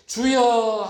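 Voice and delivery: preacher acting a heartfelt outcry; crying out to God. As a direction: cry out "Lord" as if pouring yourself out. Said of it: "자기를 토해내는데".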